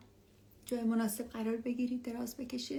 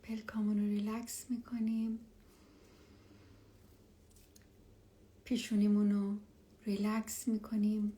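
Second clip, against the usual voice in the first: hushed and calm, guide them down; spa whisper. Acted. Leads a meditation.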